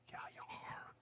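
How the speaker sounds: a hushed, smug confirmation; conspiratorial whisper; whispered aside. Real, not acted. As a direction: whisper it to the listener. Aside answering the kid's taunt.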